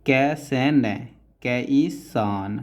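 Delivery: slow, spaced dictation of each stroke; syllable by syllable. Shown as a demonstration. Teacher to student, spelling each stroke slowly. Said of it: "teacher spelling out shorthand strokes".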